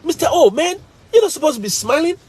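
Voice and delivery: put-on high, teasing kids' voice; mocking students. Students mocking the smiling teacher, high teasing. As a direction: tease him like cheeky students. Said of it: "they'll say".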